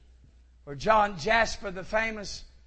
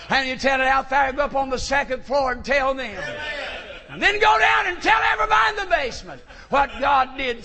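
rising shout; 3 semitones higher, 4 dB louder, faster than usual. Retold or imitated. Owner sending Jasper to tell every floor, rising shout. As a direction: send him out, louder with every floor. Owner's command, preacher climbing.

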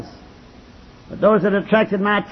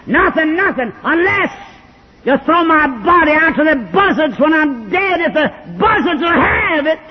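snarling reply; 7 semitones higher than usual. Retold or imitated. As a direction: snarl it back with a break in the voice. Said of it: "He snarled at me".

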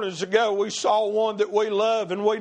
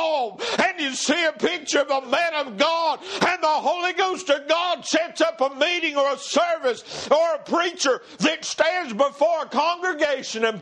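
high, fervent preacher at full tilt; driving preaching; 7 semitones higher than usual. Real, not acted. Preacher picturing a man of God before a congregation, high and fervent. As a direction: drive it upward phrase after phrase.

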